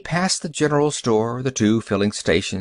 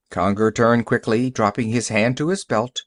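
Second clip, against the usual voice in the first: plain reading voice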